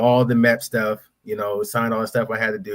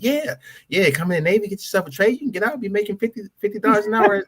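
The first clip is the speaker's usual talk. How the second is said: much higher, salesy recruiter voice; eager recruiter pitch; 10 semitones higher than usual. Retold or imitated. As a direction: sell the Navy like a hyped pitchman. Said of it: "So they're like".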